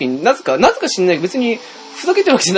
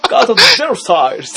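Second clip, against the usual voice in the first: higher, louder mock attack call; imitating clumsy dub; 6 semitones higher, 5 dB louder than usual. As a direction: call the move like a badly dubbed anime fighter. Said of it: "出来の悪い感じに見えるのになぜか楽しい". (下手な吹き替え風に技名を叫ぶ真似)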